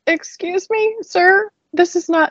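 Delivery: high-pitched, affronted retelling; mock indignation. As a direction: confront an intruder with mock politeness. Indignant retort to cockroach in story.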